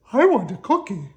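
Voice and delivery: put-on high, loud child's demand; small sibling demanding a cookie; whiny child. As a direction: whine for a cookie.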